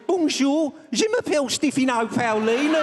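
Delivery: high-pitched Brummie French; Brummie accent. Shown as a demonstration. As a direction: speak French like a broad Brummie. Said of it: "French with a Brummie accent".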